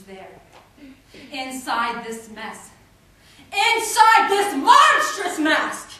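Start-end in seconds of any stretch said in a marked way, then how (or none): 3.5-5.9 slow, high, very loud cry; anguished cry. Acted. Poet crying out about her ruined face, anguished. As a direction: stretch it out at full voice.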